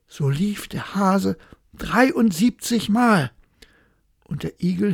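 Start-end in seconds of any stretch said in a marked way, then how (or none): none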